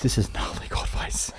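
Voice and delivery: laughing through the disclaimer, half breathed. Real, not acted. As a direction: giggle the words out softly. Laughing repeat of cohost's disclaimer.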